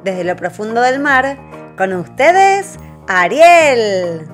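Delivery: slow, booming presentation; grand announcer reveal. Acted. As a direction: announce her like a showstopper. Announcing the princess.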